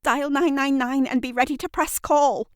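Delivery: high, firm instruction; urgent command. Acted. Kat ordering Shirley to ready the emergency call, urgent. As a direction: give the order fast and tense.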